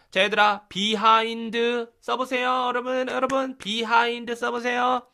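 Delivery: dictation teacher. Acted. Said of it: "받아쓰기 했을 때처럼".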